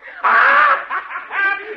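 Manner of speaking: high, loud shrieking laughter; a howling high laugh. Acted. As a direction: crack up at the sight. Laughs at colonel's look.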